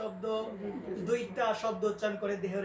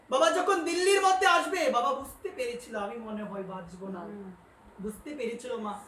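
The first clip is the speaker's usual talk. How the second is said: ordinary delivery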